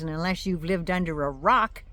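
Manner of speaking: usual speaking voice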